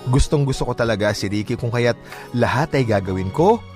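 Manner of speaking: ordinary talk, nothing marked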